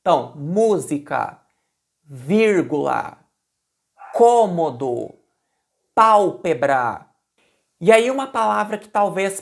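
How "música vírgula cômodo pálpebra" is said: "música", "vírgula", "cômodo", "pálpebra" slowly, first syllable stressed; antepenultimate stress. Shown as a demonstration. Teacher listing proparoxytone examples, slow with first syllables stressed. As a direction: draw out each word, leaning on its first syllable.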